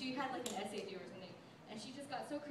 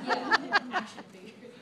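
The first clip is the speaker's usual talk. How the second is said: laughing through the words, breathy with giggles; 11 semitones higher, 18 dB louder, slower than usual. Real, not acted. Friend at wedding toast laughing about Maria's Freud action figures. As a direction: crack up while saying it.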